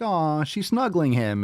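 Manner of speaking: high, drawn-out "aw" of fondness; retold cooing. Owner reacting to cuddling dogs, retold cooing. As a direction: coo slowly, high over something cute.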